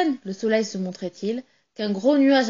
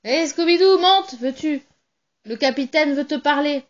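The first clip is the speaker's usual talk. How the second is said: louder and higher, hailing down to the quay; calling out; 5 semitones higher, 5 dB louder than usual. Acted. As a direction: call down from the ship's rail. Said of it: "et cria".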